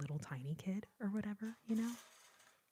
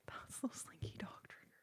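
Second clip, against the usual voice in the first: very soft, airy whisper; hushed ASMR whisper; whispered repeat; 3 semitones higher, 11 dB quieter than usual. Real, not acted. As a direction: drop right down and whisper it close. Repeats the line much softer.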